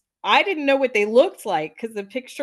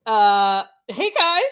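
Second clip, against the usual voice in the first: awkward greeting; 8 dB louder, slower than usual. Retold or imitated. Woman greets men barging into women's bathroom, startled and awkward. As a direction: replay a taken-aback hello.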